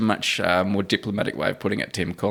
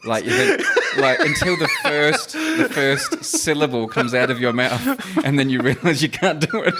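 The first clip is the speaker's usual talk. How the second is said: talking through laughter, higher pitched; laughing; 13 semitones higher than usual. Real, not acted. Co-host admitting accents fail, laughing through the words. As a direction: struggle to get it out while laughing.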